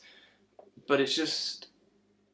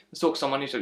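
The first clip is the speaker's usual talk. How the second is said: ordinary talk, nothing marked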